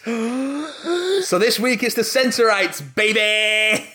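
high, slow showman drawl; hyped announcement. Retold or imitated. Reading out a fan's excited email. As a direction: sell it like a gameshow host. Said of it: "reading listener email".

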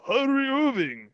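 slow, hammed-up Aussie voice; mock Australian Kyrie. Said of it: "He's like".

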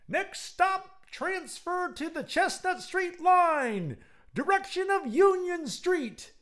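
very high, slow, loud conductor call; slow, loud, very high conductor's cry; streetcar conductor. Acted. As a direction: sing out the stops like a conductor. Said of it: "cried the conductor".

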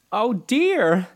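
put-on high-pitched little girl's dismay; dismayed cry. A narrator's reading. Dorothy sees the dead witch's feet, crying in dismay. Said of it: "cried Dorothy, clasping her hands together in dismay".

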